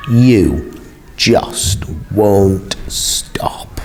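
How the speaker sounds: hissed, breathless whisper of blame; a furious sister whispering it, slow; angry whisper. Acted. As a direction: drop to a bitter whisper.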